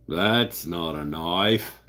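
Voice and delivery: slow, half-hearted Australian Crocodile Dundee line; a weak stab at an Australian drawl; Aussie accent. Retold or imitated. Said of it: "I can't really do the Aussie accent".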